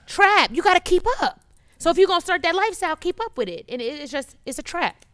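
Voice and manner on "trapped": high, loud, laughing "Trapped."; 1 laughing emphatic repeat. Real, not acted. Guest laughing as she repeats the word, high and loud. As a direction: laugh and land "Trapped" hard.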